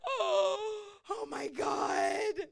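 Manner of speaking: drawn-out, slow horrified oh my God; shocked cry. Retold or imitated. Pastor reliving the moment she hurt her dog, slow horrified cry. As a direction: gasp it out, appalled.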